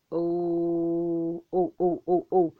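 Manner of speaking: long held monotone "ohhh", then short repeats; overwhelmed drawn-out "oh". Retold or imitated. Looking at her Lord in amazement, overwhelmed moaning oh. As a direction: draw out an awestruck oh, then stutter it.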